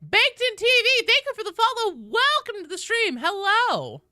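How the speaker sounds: loud, high, excited welcome; big hype welcome; excited greeting. Real, not acted. Greeting new follower.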